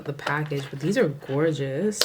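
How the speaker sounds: usual speaking voice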